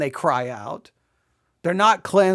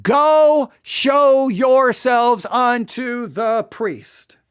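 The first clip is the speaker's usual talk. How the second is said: loud, slow, drawn-out order, each word weighted; stern command; 3 semitones higher, 4 dB louder, much slower than usual. Retold or imitated. Preacher voices Jesus ordering the lepers to the priest, commanding. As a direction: order them off slowly and forcefully. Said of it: "It's a command".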